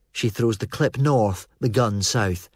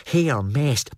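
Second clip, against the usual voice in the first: plain reading voice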